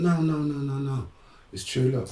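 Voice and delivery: high, sing-out retold protest; high-voiced pleading quote; insistent cousin. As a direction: imitate someone insisting, pitched well up.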